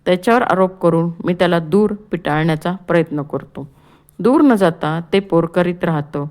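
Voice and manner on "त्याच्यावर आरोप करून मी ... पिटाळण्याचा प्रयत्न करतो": ordinary delivery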